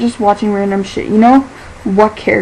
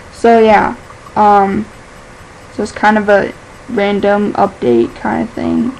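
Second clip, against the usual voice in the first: ordinary talk, nothing marked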